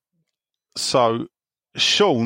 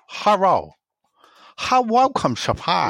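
high, lightly put-on Chinese accent; 6 semitones higher than usual. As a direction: Sean doing a mild Chinese accent. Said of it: "an impression of Sean doing a Chinese man".

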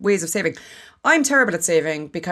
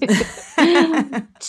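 high laugh; 10 semitones higher, 4 dB louder than usual. Real, not acted. Laughing at cramming episodes.

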